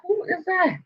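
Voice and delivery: high, hushed amazed question. Retold or imitated. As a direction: ask it softly, high-pitched, in disbelief. Reacting to unknown witness on screen.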